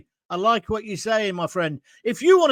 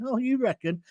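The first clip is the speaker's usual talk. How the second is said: ordinary talk, nothing marked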